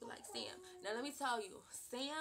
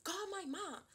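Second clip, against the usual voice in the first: insistent, higher retold demand; pushy, raised-pitch call for mom; heated demand; 9 semitones higher, 3 dB louder, slower than usual. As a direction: replay the angry teen insisting on her mom. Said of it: "'cause I'm heated".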